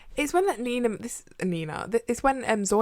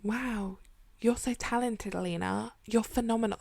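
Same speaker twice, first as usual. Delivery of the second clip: softer, airy mock admiration; soft admiring; 3 semitones higher, 6 dB quieter than usual. Mocking the show character, soft and adoring. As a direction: coo it like a smitten boy. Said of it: "he's like".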